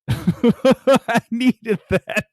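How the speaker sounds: high, chuckling relief; amused, talking through a laugh. Real, not acted. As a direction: laugh into the words. Laughs at own joke.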